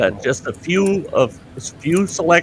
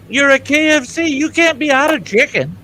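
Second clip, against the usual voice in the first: outraged; 12 semitones higher than usual. Retold or imitated. Retold outrage at KFC clerk.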